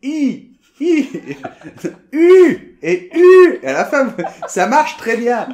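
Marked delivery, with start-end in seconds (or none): 0.0-1.1 a bright, high "I", lips spread; French I demo. Shown as a demonstration. Teacher showing the I half of the French U. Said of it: "donc ça fait". 2.1-3.6 "U" high and loud, lips rounded as for OU with tongue set for I; French U. Shown as a demonstration. Teacher loudly demonstrating the French U. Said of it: "vous gardez la bouche comme le OU".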